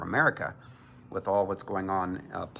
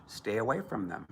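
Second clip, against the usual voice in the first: normal talking voice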